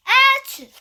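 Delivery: big high sneeze, loud and sudden. Acted. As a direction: let out a huge achoo. Elephant sneezes loudly.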